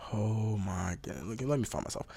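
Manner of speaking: whispered play-by-play in his head; hushed inner thoughts. Retold or imitated. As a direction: murmur it like thinking mid-play.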